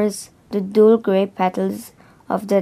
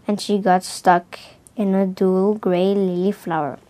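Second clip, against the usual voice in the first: plain reading voice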